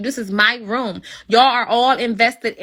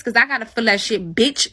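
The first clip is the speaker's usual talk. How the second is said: ordinary talk, nothing marked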